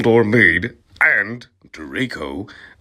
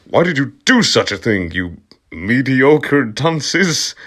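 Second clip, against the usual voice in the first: louder, higher scolding, slowing on the insult; scolding McGonagall; 6 semitones higher, 6 dB louder than usual. Acted. McGonagall scolding the students, hammed-up reproach. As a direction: scold them like a prim headmistress. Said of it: "asked Professor McGonagall".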